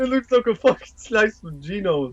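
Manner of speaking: brash street guy echoing a buddy; New York accent. Pretend. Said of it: "all that New York shit we just did".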